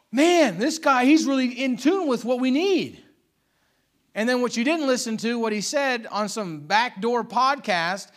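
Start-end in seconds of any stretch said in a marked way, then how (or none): 0.1-3.0 higher, louder mock gushing; mock enthusiasm. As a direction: gush like a gullible fan. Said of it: "And they go".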